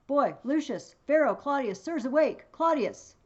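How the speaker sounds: high, loud shouts to wake sleeping servants; calling out loud. Acted. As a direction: call them awake across the tent. Brutus calling servants awake.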